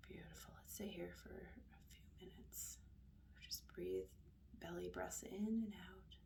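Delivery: soft, hushed meditation voice, trailing into near-whisper; quiet, unhurried guidance; guided meditation. Acted. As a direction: lead them softly, with long pauses between phrases.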